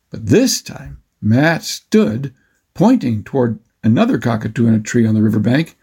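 ordinary delivery

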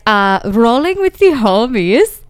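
put-on slow, drawn-out Valley girl line with a rising end; Valley girl accent. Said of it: "Valley girl accents".

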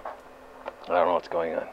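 ordinary talk, nothing marked

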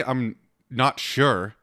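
"I'm not sure" drawn out slowly, "not" falling; slow mocking hedge. Shown as a demonstration. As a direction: stretch "I'm not sure" like a weak excuse. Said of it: "Don't say".

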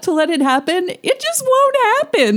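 high-pitched, singsong sarcastic naivety; mock naive. Mockingly voicing the movie's naive message.

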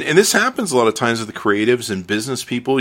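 ordinary delivery

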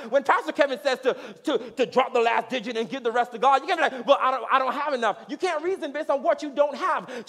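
building to high, loud, insistent preaching; impassioned preaching. Real, not acted. Preacher urging the congregation not to reason from lack, fervently. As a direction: drive each point home with rising force.

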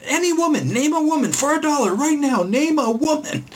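high, pushy demanding yell, slowing at the end; hammed-up frantic quizmaster; imitating Billy Eichner. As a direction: badger her for an answer. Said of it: "runs up to random people".

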